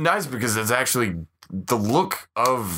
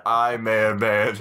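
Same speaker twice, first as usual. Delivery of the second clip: normal talking voice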